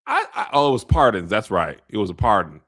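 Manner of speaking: usual speaking voice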